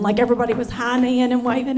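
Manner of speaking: usual speaking voice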